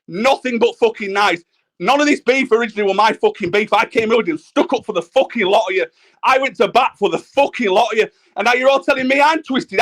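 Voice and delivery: usual speaking voice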